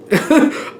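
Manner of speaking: chuckling at the memory; airy laugh. Real, not acted. Recalling the person leaving, airy laugh.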